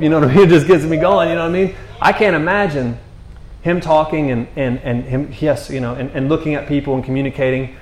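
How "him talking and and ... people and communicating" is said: ordinary delivery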